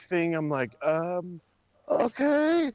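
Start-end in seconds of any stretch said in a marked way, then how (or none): 1.9-2.7 okay leaping way up in doubt; a baffled, rising okay; skeptical okay. Real, not acted. Unimpressed by the mark storyline, skeptical.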